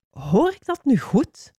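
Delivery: high, slow, incredulous question; indignant disbelief. A narrator's reading. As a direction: draw the question out, affronted. Offended disbelief in a quarrel. (verontwaardigd, langzaam en hoog)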